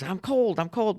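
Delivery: put-on high whiny child's voice; a child complaining he's cold; little boy. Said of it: "She goes".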